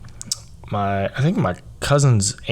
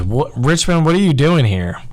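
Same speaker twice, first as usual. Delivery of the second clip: higher, louder mock coach surprise; surprised coach; 7 semitones higher, 5 dB louder than usual. Coach surprised to see a cut player show up, higher and louder. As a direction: toss it out like a startled coach. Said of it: "the first thing this coach says to me".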